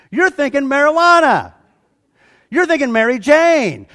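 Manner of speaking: teasing. Real, not acted. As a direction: poke fun at the students.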